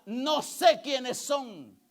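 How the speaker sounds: stately acted judgment; voice of the Lord. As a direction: draw it out as a divine rejection. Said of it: "Imagínese cuando sea el Señor".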